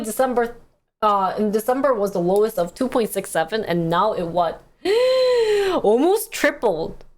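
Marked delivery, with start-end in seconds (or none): 4.8-5.8 high sharp intake of breath; shocked gasp. Real, not acted. Streamer gasping at mortgage rates. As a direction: gasp in alarm.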